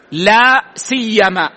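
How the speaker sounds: لا سيما said slowly with no و before لا; teacher models لا سيما without the و; pronunciation demo. Shown as a demonstration. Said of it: "ويجوز حذف الواو". (نطق لا سيما بدون واو ببطء)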